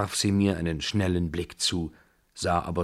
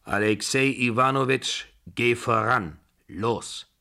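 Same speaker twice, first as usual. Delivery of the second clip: put-on louder old lady giving orders; commanding old lady; 5 semitones higher, 5 dB louder than usual. A narrator's reading. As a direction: bark it like a matriarch. Babuschka commands.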